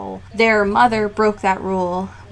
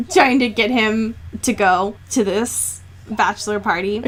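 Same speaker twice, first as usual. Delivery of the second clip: ordinary talk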